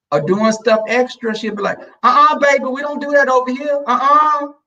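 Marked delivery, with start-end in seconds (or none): 2.0-4.5 high, loud scolding mama; high-pitched sassy refusal; mother's scolding. Retold or imitated. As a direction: shut it down sharply, high and loud. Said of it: "My mother was like that".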